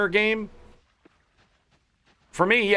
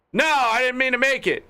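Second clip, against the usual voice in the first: alarmed protest; 8 semitones higher than usual. Real, not acted. Streamer panics as the game crafts the wrong item, high alarmed protest. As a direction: pitch jumps high in real alarm.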